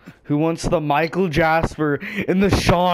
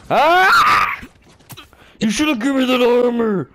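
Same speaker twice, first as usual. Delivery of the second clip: angry shout; 7 semitones higher, 3 dB louder than usual. Real, not acted. Angry outburst in game.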